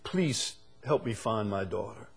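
soft, pleading plea. Retold or imitated. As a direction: beg quietly for help. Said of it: "this father begged".

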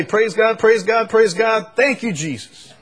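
loud, high parroted praise; parrot mimicking dad. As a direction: repeat it like the parrot copying dad. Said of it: "He would say".

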